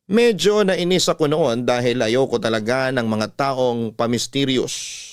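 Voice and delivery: ordinary talk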